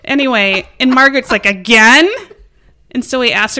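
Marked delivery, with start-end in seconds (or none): 1.5-2.1 exasperated. Retold or imitated. Wife exasperated.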